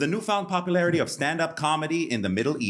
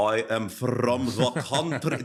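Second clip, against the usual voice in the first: self-mocking thick Lebanese accent; exaggerated Lebanese accent. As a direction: hear yourself with a heavy Lebanese accent. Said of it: "That's what it sounds like to me".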